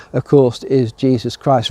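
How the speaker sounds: normal talking voice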